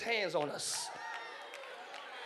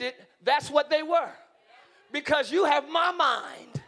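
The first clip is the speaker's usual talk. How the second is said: louder, rising sermon delivery; preacher building to a climax; preaching at full force; 4 semitones higher, 4 dB louder than usual. Acted. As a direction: drive it home with conviction.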